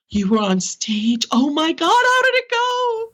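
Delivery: high, squealing, drawn-out delight; excited friend. Retold or imitated. Supportive women asking how her stage show went, squealing delight. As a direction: gush like a proud friend.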